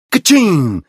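high, showy exclamation; shouted catchphrase. A narrator's reading. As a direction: cry it out posing for cameras. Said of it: "выкрикивал блестящий гоночный автомобиль".

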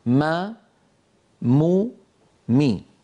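isolated "ما، مو، مي", lips parting from m into each vowel; "ما، مو، مي" as separate drill syllables, each vowel sounded clearly; demonstrating voweled meem. Shown as a demonstration. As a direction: say "ما", "مو", "مي" one at a time as model syllables. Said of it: "يخرج بالتباعد بين طرفي عضو النطق".

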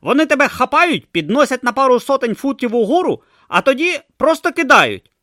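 high and loud, indignant; grumpy tortoise god. Acted. Tortoise god to Brutha about eagles dropping him, high indignant. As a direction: complain about eagles like an offended deity. Om voice held.

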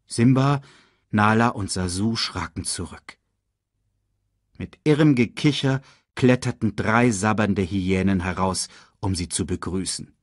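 ordinary delivery

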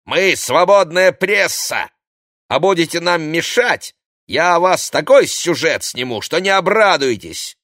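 high, loud defiant threat; spiteful shouting. A narrator's reading. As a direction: shout the mayor down. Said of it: "злобно крикнула Ксения".

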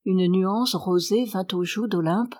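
plain reading voice

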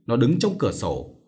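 usual speaking voice

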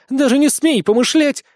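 high-pitched, forceful scolding; indignant warning. A narrator's reading. Witch warning the boy off, indignant.

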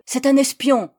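loud, high accusation; hateful yell. A narrator's reading. Persecutor denounces the foreigner as a spy, hateful yell. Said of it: "me hurla, avec un visage déformé par la haine".

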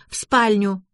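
hint of a girl insisting; lightly voiced insistent girl. A narrator's reading. Girl insists, narrator echoes her.

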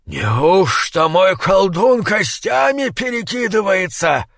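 usual speaking voice

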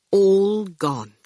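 usual speaking voice

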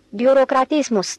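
usual speaking voice

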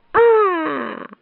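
drawn-out high "aaah", sliding down; high wailing scream. A narrator's reading. The Queen says she will prick her finger, wailing scream. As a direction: wail it high and long.

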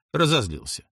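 plain reading voice

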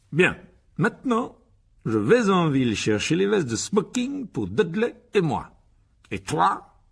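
high, loud, slow orders; bossy pompous uncle. Acted. Uncle assigning the errands before dinner, bossy and self-important. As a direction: announce it with household authority.

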